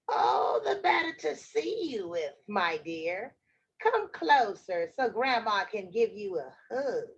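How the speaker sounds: put-on granny voice, dropping at the end; wolf as Grandma. Wolf lures Red to the bed, fake granny voice. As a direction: coax sweetly in a fake granny voice.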